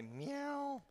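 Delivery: high, soft little kitty meow; cat voice. Shown as a demonstration. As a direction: meow like a small scared kitten. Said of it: "in your best cat voice".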